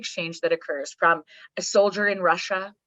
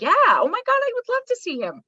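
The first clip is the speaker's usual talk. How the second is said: high, gushing yes to a friend; 热情答应; 3 dB louder than usual. Acted. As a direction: answer with exaggerated delight, pitch soaring. Agreeing to a friend's favour.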